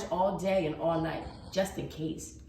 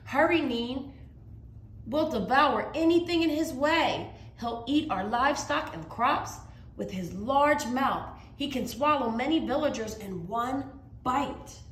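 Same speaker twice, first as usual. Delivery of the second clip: higher, louder urgent warning; urgent mother; 7 semitones higher, 4 dB louder than usual. Acted. Mama warns Mai of the dragon, higher and louder, urgent. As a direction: hurry her child into hiding.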